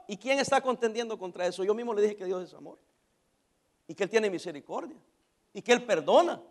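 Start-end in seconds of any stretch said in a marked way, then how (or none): none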